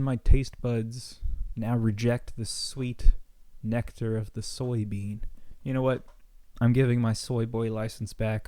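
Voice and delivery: ordinary talk, nothing marked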